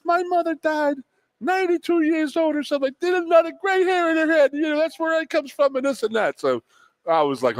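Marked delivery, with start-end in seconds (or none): 0.1-6.4 put-on squeaky old coach laughing it off; Joe imitation. Coach explaining his gray hair, much higher mock voice. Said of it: "He goes".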